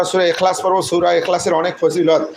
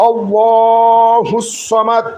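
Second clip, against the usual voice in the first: long, loud, higher chant of আল্লাহুস Samad; loud recitation; 4 semitones higher, 4 dB louder, much slower than usual. Shown as a demonstration. Teacher leading the class aloud: loud, very slow. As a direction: sing it out loud and drawn out. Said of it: "জোরে জোরে বলবো".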